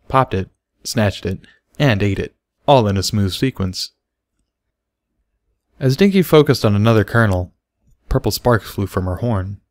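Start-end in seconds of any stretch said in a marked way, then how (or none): none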